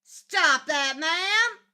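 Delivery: loud, high call across the room. Real, not acted. Scolding the pet from across the room, loud call. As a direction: shout it at the pet from afar.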